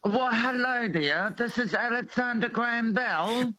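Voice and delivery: put-on English accent. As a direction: play a telephone inventor with an English accent. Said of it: "do an English accent".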